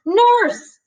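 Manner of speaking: high, loud call for help; strapped to the bed, she shouts for the nurse; yelled out. A narrator's reading. Patient in restraints yelling for the nurse, high and loud. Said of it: "She yelled out".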